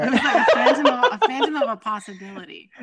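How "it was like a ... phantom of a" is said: loud laughing quote. Real, not acted. Guest laughing while repeating host's phrase.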